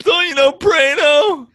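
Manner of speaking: high, loud mocking voice; whiny, shouted mock rant; Matty Goldberg. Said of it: "Somewhere Matty Goldberg's like".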